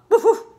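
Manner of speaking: loud, high barking woof; playful loud dog bark. Acted. Dog sound in story.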